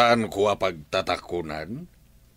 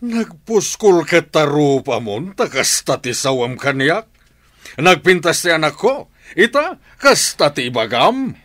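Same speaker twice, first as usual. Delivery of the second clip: loud, high angry rebuke; angry outburst; 8 semitones higher, 8 dB louder than usual. Acted. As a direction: scold the young man hotly. Father angered by refusal.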